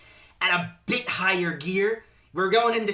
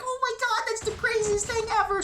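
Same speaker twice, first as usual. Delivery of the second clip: high-pitched mock scream at usual volume. Boys reacting to the crazy phone call, mock screaming. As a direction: squeal it very high, without real volume. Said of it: "we were all screaming and being".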